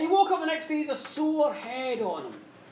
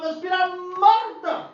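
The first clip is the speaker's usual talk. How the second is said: mock alarmed cry; 4 semitones higher, 6 dB louder than usual. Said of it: "they thought".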